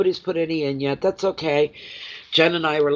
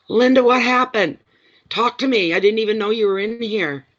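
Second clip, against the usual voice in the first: high, eager questioning; real excited urgency, pitched up; urgent surprise; 8 semitones higher, 4 dB louder than usual. Real, not acted. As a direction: burst in loud, then press for news. Urgently asks Linda for news.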